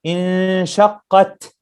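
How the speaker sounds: انشقت slowly, final ta aspirated; breathy final ta. Shown as a demonstration. Teacher isolates the breathed ta. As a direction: puff air after the ta of انشقت. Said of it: "because ta needs a hem".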